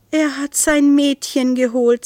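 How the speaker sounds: delighted amazement. A narrator's reading. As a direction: marvel fondly at a sweet sight. Isolde delighted at the two cats.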